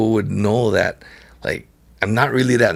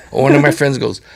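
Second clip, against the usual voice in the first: normal talking voice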